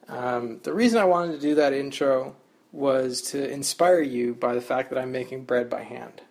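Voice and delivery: usual speaking voice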